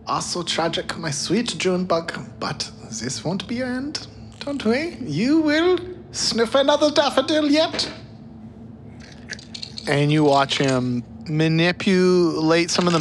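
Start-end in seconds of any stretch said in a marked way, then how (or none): 0.1-7.9 gentle mad scientist. Acted. Lab scientist soothing his dead dog, high doting voice. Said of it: "The lab guy speaks".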